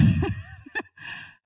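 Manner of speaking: squealing burst of laughter; real laugh at the sandal story; high real laugh. Real, not acted. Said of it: "it just makes me laugh so much".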